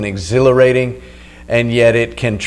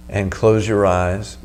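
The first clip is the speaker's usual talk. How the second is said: right in your ear; 3 semitones lower, 7 dB quieter than usual. Acted. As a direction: close and calm, guiding the breath. Leads a breathing meditation.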